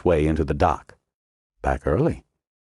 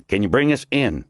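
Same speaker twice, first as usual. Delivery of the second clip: short, higher, brusque demand; brusque question; 6 semitones higher than usual. A narrator's reading. Commando cuts Snow off, brusque. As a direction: cut him off sharp. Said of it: "the man said brusquely".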